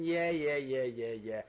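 dismissive yeah yeah. Retold or imitated. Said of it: "Don't sit there with your arms folded thinking".

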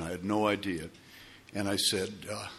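ordinary talk

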